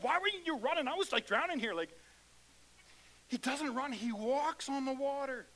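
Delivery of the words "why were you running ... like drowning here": mock complaint to Jesus. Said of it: "That's how we'd be talking to Jesus".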